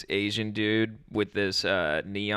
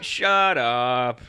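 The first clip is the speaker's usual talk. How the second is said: louder, drawn-out mock shout; mock retort; 6 dB louder than usual. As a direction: shout them down for laughs. Retort to mocked couple.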